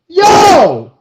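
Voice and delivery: high, loud drawn-out yell. Retold or imitated. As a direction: yell it out to stop someone. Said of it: "I mean, I yelled".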